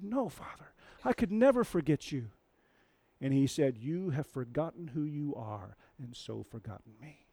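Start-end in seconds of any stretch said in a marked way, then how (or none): 0.0-2.3 higher, earnest plea; pleading son. Retold or imitated. Simba pleading with his father, voice raised. 3.8-7.3 soft, fading rebuke; solemn father. Retold or imitated. Mufasa rebuking Simba from the clouds, softly.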